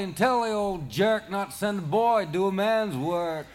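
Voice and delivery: high, loud triumphant yell; triumphant shout. A narrator's reading. Charlie sends the knocked serpent home, loud triumphant yell. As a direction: crow it over the beaten serpent.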